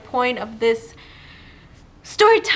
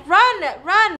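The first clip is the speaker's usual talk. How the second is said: sharp urgent yell, higher and louder; shouted run; 6 semitones higher, 4 dB louder than usual. Retold or imitated. Shouting to her frozen friend during the escape, urgent yell. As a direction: scream at her to move.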